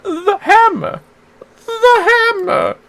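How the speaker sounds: a shaken thief repeating it in fright; panicked thief. Acted. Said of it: "kept muttering the words".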